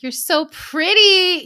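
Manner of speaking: slow, high, loud cooing; cooing to tree. Retold or imitated. Rubbing the tree's leaves, slow high loud coo. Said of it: "And I'm just like".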